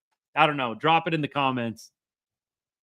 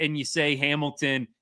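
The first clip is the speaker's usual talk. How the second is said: ordinary delivery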